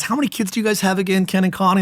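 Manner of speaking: hint of a chatty prophetic woman; lightly voiced prophet lady. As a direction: ask it brightly. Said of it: "she says".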